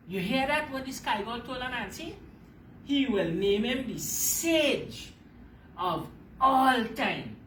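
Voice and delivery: ordinary delivery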